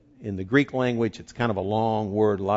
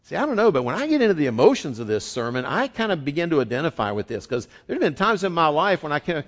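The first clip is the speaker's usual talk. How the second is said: ordinary delivery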